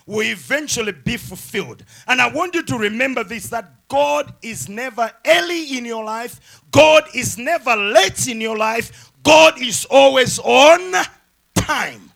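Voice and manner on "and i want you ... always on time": emphatic preaching. Real, not acted. Preacher assures congregation about God's timing, building emphatic preaching. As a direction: swell to a shouted last phrase.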